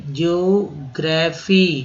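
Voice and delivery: Geography stretched slowly, jyo-gra-fee; slow drawn word. Shown as a demonstration. Teacher repeats geography slowly for students. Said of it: "भूगोल, geography".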